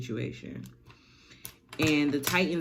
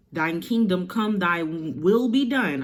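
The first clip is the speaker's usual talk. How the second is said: mock loud prayer; 2 semitones higher, 5 dB louder than usual.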